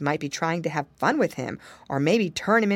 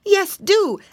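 pleading monkey; 13 semitones higher, 7 dB louder than usual. A narrator's reading. Monkey joins plea to alligator.

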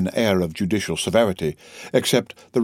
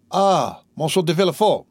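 high-pitched, eager cry of greeting; exclaimed greeting; 7 semitones higher than usual. A narrator's reading. Shipowner greeting the magistrate he waited for, exclaimed. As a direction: call out his name, lifted in pitch. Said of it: "cried he".

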